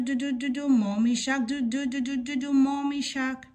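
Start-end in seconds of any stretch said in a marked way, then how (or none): none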